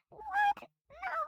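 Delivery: very high, loud startled what; shocked shriek. Acted. Boy to the nightmare, very high shocked shriek.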